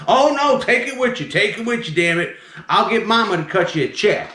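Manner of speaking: high, alarmed southern man; southern accent. Retold or imitated. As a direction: imitate a flustered southern customer refusing. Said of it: "he's talking with his southern accent".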